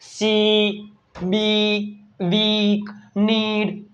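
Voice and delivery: ee held long and level in "સી"; word-by-word drill, drawn-out ee in "નીડ". Shown as a demonstration. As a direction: stretch the vowel of "વીક". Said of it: "double ઈ એટલે કે દીર્ઘાઈ".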